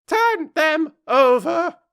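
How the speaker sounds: loud, very high, drawn-out order; angry Queen's command. A narrator's reading. Queen angrily ordering the Knave, high loud shout. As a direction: shriek the order, stretching each word. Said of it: "turned angrily away from him".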